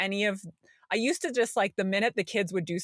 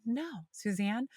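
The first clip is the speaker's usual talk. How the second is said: quiet self-reminder; 2 semitones higher, 7 dB quieter than usual. Retold or imitated. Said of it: "And I was like".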